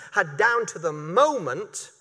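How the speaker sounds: ordinary talk, nothing marked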